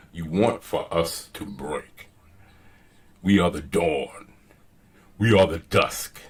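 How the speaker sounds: very low, soft, ominous trailer voice; emphatic bass reading. Shown as a demonstration. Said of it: "put some emphasis on it".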